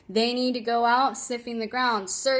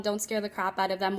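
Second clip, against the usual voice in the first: ordinary talk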